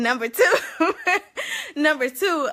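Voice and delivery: loud laugh through the words. Real, not acted. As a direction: laugh out number two. Laughs mid list.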